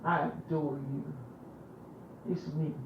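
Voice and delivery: soft, earnest prayer addressed to God; intimate prayer. Acted. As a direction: pray it close and devoted.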